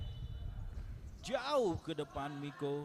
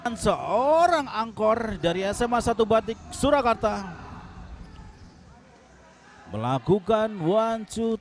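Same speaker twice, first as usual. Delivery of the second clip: excited; 4 semitones higher, 7 dB louder than usual. Real, not acted. Recap right after goal.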